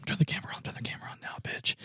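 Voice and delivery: urgent unvoiced whisper. Producer whispering to the crew to start filming. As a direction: hiss the order under your breath.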